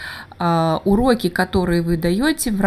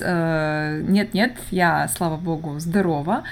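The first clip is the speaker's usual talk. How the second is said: ordinary talk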